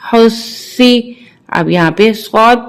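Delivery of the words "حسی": حسی very slow with the ص held long and firm; doubled sad. Shown as a demonstration. Teacher shows children how to double the ص, slowly. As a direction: lean on the doubled ص in حسی and draw it out. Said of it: "اس کو تھوڑا سا سختی سے پڑھیں گے".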